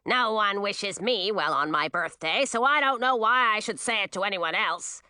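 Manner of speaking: put-on sour old witch complaining; peevish, self-pitying grumble; grumbling. A narrator's reading. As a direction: grumble on in a grudging character voice. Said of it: "grumbled Ratinky".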